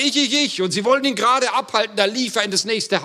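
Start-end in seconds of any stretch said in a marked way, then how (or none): 0.0-0.6 put-on high, eager child volunteering; eager little boy. Little boy volunteering for the wheelbarrow, high and eager. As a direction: pipe up like a keen little boy. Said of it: "ein kleiner Junge und sagte".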